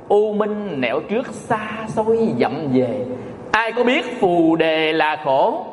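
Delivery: slow, drawn-out verse recitation; reciting verse. Acted. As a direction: stretch each syllable like chanted poetry. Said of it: "có câu thơ á". (ngâm thơ chậm rãi)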